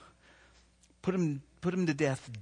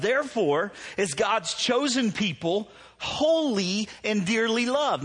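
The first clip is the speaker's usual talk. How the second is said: slow, higher and louder reading of the verse; emphatic scripture reading; 6 semitones higher, 3 dB louder, slower than usual. Real, not acted. Preacher reading the verse to the congregation, slow and emphatic. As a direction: lean into every word of the verse.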